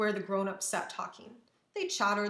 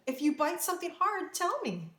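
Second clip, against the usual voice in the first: high-pitched, insistent directions; urgent instruction; 8 semitones higher than usual. A narrator's reading. Maria urgently instructs her cousins.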